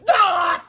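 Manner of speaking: high, loud scream; frustrated scream. A narrator's reading. Principal fed up with the secretary, frustrated scream. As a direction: scream out in exasperation. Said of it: "screamed Mister Krupp in frustration".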